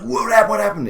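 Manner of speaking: high, loud startled mom waking up; imitating a panicked wake-up cry. Mom startled awake in the night.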